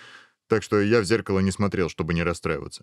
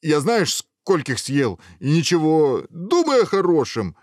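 loud, high-pitched, over-eager reassurance; hasty consoling; 8 semitones higher, 5 dB louder than usual. Acted. Ogre backtracking to console the prince, loud reassurance. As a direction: cheer them up a bit too forcefully.

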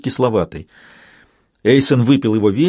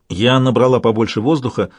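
ordinary delivery

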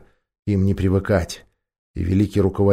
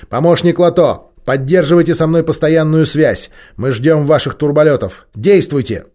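loud commanding; 6 semitones higher, 6 dB louder than usual. Acted. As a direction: overrule everyone with force. Leader overrides all objections.

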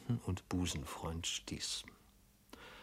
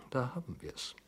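murmured; 5 semitones higher, 7 dB quieter than usual. Acted. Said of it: "murmelte unser Held".

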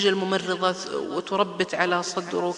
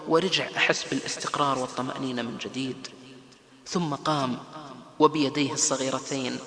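ordinary talk

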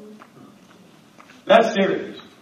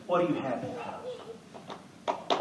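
soft prophet question; 12 semitones higher, 17 dB quieter, slower than usual. Acted.

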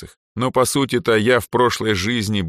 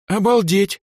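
high, loud blurt of amazement; shocked exclamation; 14 semitones higher, 5 dB louder than usual. A narrator's reading. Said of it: "Эльза в шоке".

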